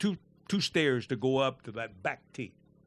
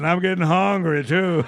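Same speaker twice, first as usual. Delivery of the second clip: louder, laughing through the line; laughing joke; 2 semitones higher, 8 dB louder than usual. Retold or imitated. Caddy joking back at the heckler, laughing. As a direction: crack the joke while laughing.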